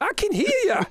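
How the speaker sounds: high, laughing elf taunt; teasing elf. Acted. Elf taunting the approaching dwarf, high laughing voice. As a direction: chuckle it out high and smug.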